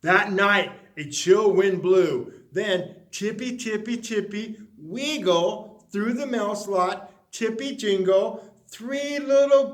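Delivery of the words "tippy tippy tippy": ordinary delivery, nothing marked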